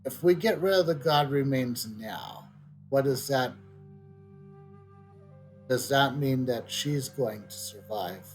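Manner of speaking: normal talking voice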